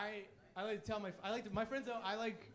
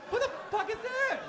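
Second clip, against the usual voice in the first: much higher, laughing, startled question; startled coworker; 13 semitones higher, 7 dB louder than usual. Retold or imitated. Office worker startled to find a stranger at the desk, high and laughing. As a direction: shoot it up high in disbelief.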